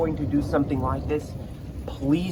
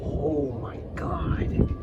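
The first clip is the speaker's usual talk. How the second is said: awed whisper; much slower than usual. Real, not acted. Storm scares the vlogger.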